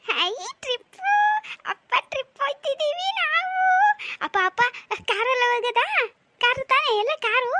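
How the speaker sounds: put-on high, loud, bouncing child voice; excited little girl. Little daughter to her father about the trip, high excited child voice. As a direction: squeal it out like a thrilled little daughter.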